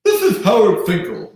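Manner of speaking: lightly voiced ring announcer call; a hint of the booming announcer intro; Howard Finkel impression. Imitating the announcer leaving a voicemail. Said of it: "Everyone has the imitation".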